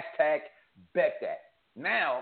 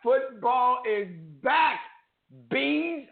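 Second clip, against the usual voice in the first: drawn-out hype; 6 semitones higher, much slower than usual. Acted. Opening the football segment.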